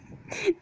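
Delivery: high giggling laugh; burst of laughter. Real, not acted. Laughing at her own chimp joke.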